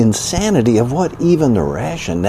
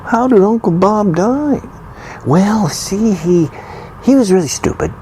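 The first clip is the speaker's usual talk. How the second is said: mock mourner; 9 semitones higher than usual. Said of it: "they go to a funeral and say".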